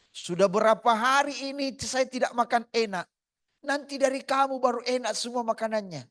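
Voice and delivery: high and loud; impassioned preaching. Acted. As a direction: carry the old man's line with fervor. Preaching the old man's words.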